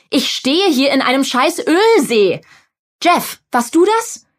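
wütendes Schreien. A narrator's reading. Sue tobt in der Werkstatt.

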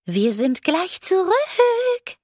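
put-on high, slow, sugary ghost girl; sweet ghost girl voice. A narrator's reading. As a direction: sing it sweetly like an eerie ghost child. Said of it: "sagte Katze mit süßer Stimme".

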